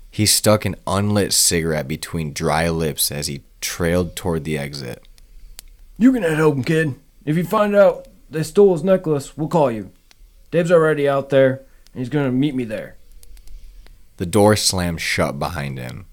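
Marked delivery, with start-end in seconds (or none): 6.0-12.9 higher, louder gruff brush-off; an old cop dismissing the kid; raspy gruff detective. A narrator's reading. Al voiced in the story.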